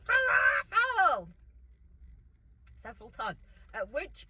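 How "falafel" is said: very high, drawn-out yell; mock scream. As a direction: scream it slowly, hammed-up. Retold escalating shouting match with mom.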